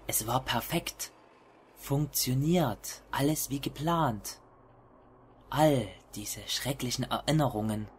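low, hushed horror story narration; hushed creeping horror. A narrator's reading. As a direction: read it like a creeping, dark confession. (leise, schleichende Horror-Erzählung)

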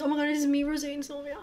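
high, soft, emotional gushing; overwhelmed, tearful fangirl voice; about to cry. Real, not acted. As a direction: squeak it out softly, close to happy tears. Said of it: "I'm about to cry".

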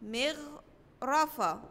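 مغرفة said slowly and softly; model word. Shown as a demonstration. Teacher models the ghain word slowly for first graders. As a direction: draw out مغرفة for the children. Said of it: "زي كلمة إيه؟".

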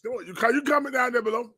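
ordinary talk, nothing marked

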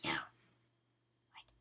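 hushed, airy whisper. Pretend. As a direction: whisper it under your breath. Voiced item escaping, whispering.

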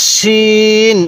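শিন with a thick sh and long-held vowel; thick sheen. Shown as a demonstration. Teacher contrasts two confused letters, thick sh in শিন. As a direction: make the sh of শিন full, then stretch it evenly. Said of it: "একটু মোটা করে উচ্চারণ".

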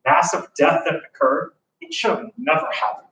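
ordinary delivery

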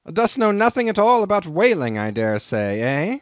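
crusty Nantucket captain sizing up a greenhorn; gruff old sea captain. Acted. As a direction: bristle at the stranger like a crusty old skipper. Old captain mocks Ishmael.

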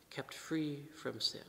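slow, breathy whisper; hushed whisper. Real, not acted. Preacher hushing the congregation, slow whisper. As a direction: drop to a reverent whisper.

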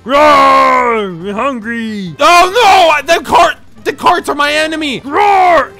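loud, slow, hungry monster voice; monster roar. Pretend. Gamer voicing Big Daddy as a hungry monster. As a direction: growl it out like a big beast.

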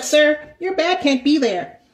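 high, slow, prim scolding; gym Karen voice. Retold or imitated. Gym Karen telling him his bag can't stay there, slow and high. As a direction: drag it out like a fussy rule-keeper. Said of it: "she like".

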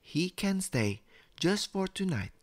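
high, soft-edged mum voice; smiling mother. A narrator's reading. Mummy lets the crocodile stay, smiling. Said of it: "Mummy smiled".

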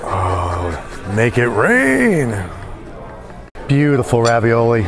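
usual speaking voice

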